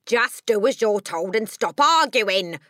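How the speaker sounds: loud, slow, rough father's rebuke; snapped order. A narrator's reading. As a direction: snap at the child to obey. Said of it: "the father snapped".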